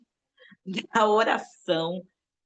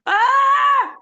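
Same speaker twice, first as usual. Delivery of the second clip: high, much louder piercing scream; full scream in prayer; 10 dB louder than usual. Shown as a demonstration. Preacher shows the church a real prayer scream. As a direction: let out a full-throated scream of release. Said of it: "Gritar mesmo".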